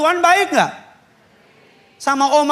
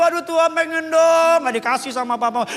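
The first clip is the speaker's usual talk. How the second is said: put-on whiny teen; 5 semitones higher than usual. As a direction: whine like a spoilt kid begging. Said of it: "party itu ada miras".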